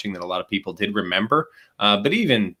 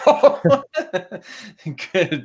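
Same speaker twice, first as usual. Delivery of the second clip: laughing hard, much higher and louder; 11 semitones higher, 4 dB louder than usual. Real, not acted. Father laughing at his son's sharp question, high loud laughter. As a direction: laugh through the words, caught out.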